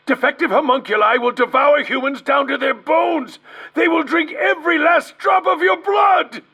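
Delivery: terrified researcher shouting a last warning; panicked shout. Acted. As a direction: scream the warning as the monster closes in. Doctor panics as monster arrives.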